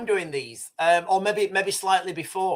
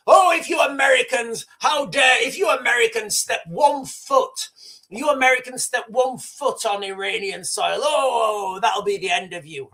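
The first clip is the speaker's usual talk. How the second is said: mock tough talk; 3 semitones higher than usual. Streamer imitates Iranians threatening US troops, mock tough-talking bluster. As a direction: bluster like an outraged army spokesman, fading towards the end. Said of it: "will be tough talking".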